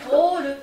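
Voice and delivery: Toru said high and loud; Toru, punched loud and high; emphatic model. Shown as a demonstration.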